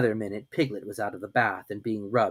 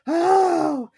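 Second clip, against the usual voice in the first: high yelp; 6 dB louder than usual. A narrator's reading. Piglet cries out falling.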